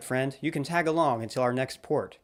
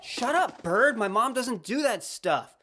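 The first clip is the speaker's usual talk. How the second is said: angry snap; 9 semitones higher, slower than usual. Acted. Hero snaps at bird.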